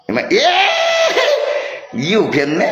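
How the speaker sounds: high squealing laugh-shout; laughing yell. Real, not acted. Gamer cracking up loudly at a friend's play. Player bursts out laughing, yelling.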